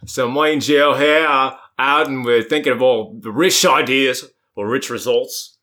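broad Australian, pitched a little up; trying out an Australian accent for the first time. Shown as a demonstration. Asked to show an audition accent, Australian accent. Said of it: "do your French or Australian accent".

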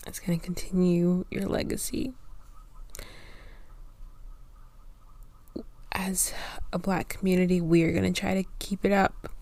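hushed, whispered and choked; soft tearful thanks. Real, not acted. Host addressing late hero, soft tearful whisper. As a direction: quietly thank him through tears. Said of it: "I'm literally crying".

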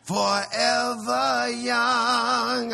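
very slow, high and loud; hyped praise to the crowd; slow shout. Real, not acted. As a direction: stretch it out and belt it. Praising the daughter.

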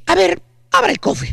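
commanding customer ordering the salesman; bossy, know-it-all tone; authoritative voice. Acted. Chúntaro orders the car salesman, mild authoritative voice. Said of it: "con voz autoritaria le dice".

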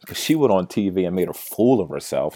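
normal talking voice

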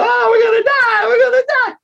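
high panicked wail; scared children wailing. Acted. Blinded children in the basket, high panicked wailing. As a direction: wail like terrified kids. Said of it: "So they're going".

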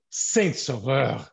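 Saint Sauveur drawn out slow as a curse; swear-style Saint Sauveur. Shown as a demonstration. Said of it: "like that, it's a swear".

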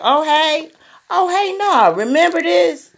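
high-pitched, acted-out hello; mock friendly greeting. Imitating herself greeting someone who offended her, high mock-friendly.

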